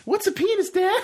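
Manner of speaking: put-on very high shouted kid squeak, laughing; Jake voice. Host as Jake asking his dad, high laughing kid shout. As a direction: yell it like a clueless little boy. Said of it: "you be Jake".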